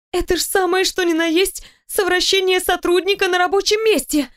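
high-pitched scandalised outcry; inner cry. A narrator's reading. Employee inwardly decrying seduction at work, high outcry. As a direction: keep it pitched high, an alarmed thought. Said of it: "мысленно завопила я".

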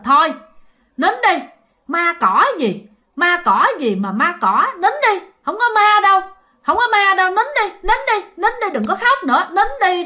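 high, firm hush to stop her crying; hushing old woman. Retold or imitated. Grandmother hushing the crying girl, firm and high.

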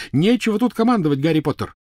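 high, loud rebuke; angry shout. Acted. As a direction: yell him down furiously. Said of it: "крикнула она".

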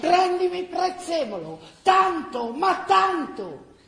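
high, loud plea, dragging the last tanto; insistent confused old mother. Acted. Elide begs Gina for parsley, high, loud and insistent.

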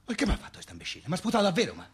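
ordinary talk, nothing marked

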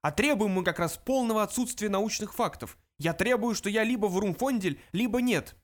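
high-pitched insistent demand; shouting. A narrator's reading. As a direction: keep up the strident protest. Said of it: "закричал Врумфондель".

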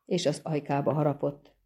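usual speaking voice

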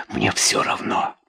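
hushed, breathless whisper into her hands. A narrator's reading. Andra alone in the storm whispering her despair. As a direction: whisper it as if to herself, barely voiced. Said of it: "шептала она".

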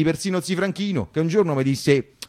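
ordinary delivery

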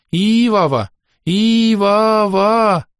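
drawn-out calls, slow on the second; calling out. Retold or imitated. Daughter retelling the dragon calling his goddess, slow mock call. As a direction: call the name like shouting across a distance. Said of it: "Прямо так и кричал".

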